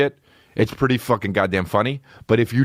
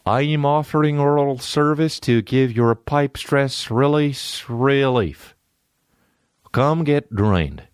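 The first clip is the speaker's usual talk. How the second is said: robot ad voice; 4 semitones higher than usual. Retold or imitated. Reading robot's ad.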